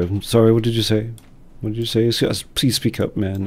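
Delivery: a mock loud please speak up; mock complaining listener. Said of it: "so often do people go".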